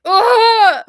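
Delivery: loud, high groan of pain. Retold or imitated. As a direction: cry out like the needle hurts. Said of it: "I was like".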